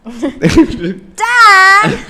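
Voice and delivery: Meg whine. Acted. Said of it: "you would wanna play Meg".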